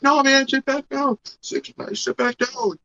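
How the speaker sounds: put-on squeaky bossy child ordering him; mock bratty kid.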